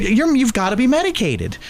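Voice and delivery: high, teasing incredulity; mock disbelief. Telling coworker he must be medicated, high teasing disbelief. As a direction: tease him with wide-eyed disbelief. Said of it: "I said".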